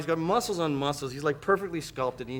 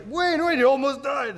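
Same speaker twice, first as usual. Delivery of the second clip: excited crowd; 10 semitones higher than usual. Retold or imitated. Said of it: "it's just like".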